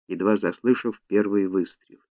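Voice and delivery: plain reading voice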